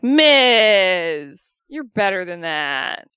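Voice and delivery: playful chiding of a friend who told a bad joke; mock scolding. Voicing Maurice scolding Miz.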